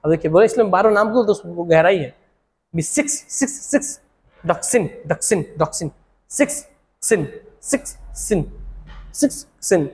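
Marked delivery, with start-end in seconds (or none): 2.7-9.9 softly repeating "six" and "sin"; sound matching. Shown as a demonstration. Teacher softly linking six to the drug name. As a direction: murmur the sounds over and over, much softer. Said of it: "question में answer छुपा देना है".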